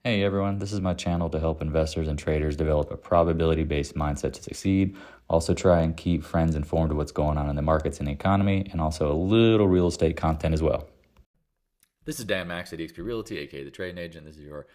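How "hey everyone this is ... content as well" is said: loud, punchy YouTube intro; announcer-style channel intro. Acted. Host opening a weekend market review to viewers, loud announcer intro. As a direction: announce the show at full volume.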